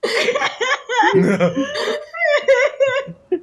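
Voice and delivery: long high-pitched laugh; high laughter. Real, not acted. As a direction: burst out laughing. GM breaks up laughing.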